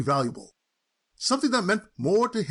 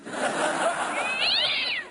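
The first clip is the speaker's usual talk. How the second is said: mocking high cackle. Cousin mocks pussycat inheritance.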